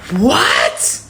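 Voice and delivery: high, drawn-out outraged yell; mock phone scream, stretched words; retold screaming. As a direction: act out a man screaming down the phone. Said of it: "starts screaming on the phone".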